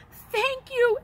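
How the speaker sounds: high, bursting thank you; first thank you pitched high and grateful; grateful cry. A narrator's reading. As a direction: thank him with overflowing relief. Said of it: "he cried".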